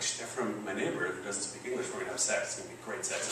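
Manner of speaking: softly voiced daydream; hushed inner thought. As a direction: think it aloud quietly, as if to yourself. His secret first thought, acted.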